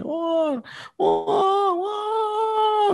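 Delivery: raungan sangat tinggi seperti anak menangis; tangis mengamuk. Retold or imitated. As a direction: tiru tangisan anak yang ngamuk. (tangisan anak mengamuk, sangat tinggi)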